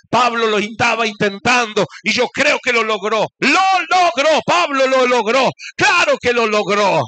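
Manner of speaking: loud, ringing preacher's cry; preaching at full force. Real, not acted. Preacher rallying the congregation, full-force fervent shout. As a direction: lift the church with a fervent shout.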